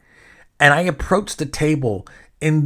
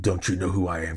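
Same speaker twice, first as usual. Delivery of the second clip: a hint of a pompous don't-you-know-me tone, lower and softer; mock entitled celebrity; 7 semitones lower, 5 dB quieter than usual. Host mocking himself as an entitled star, lower and softer. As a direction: toss it off as a self-mocking diva line. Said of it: "this is gonna sound so, like".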